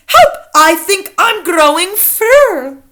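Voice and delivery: high, loud, drawn-out cry for help; slow, panicky storybook scream. A narrator's reading. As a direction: scream it as an alarmed boy. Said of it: "A boy who's standing next to her screams".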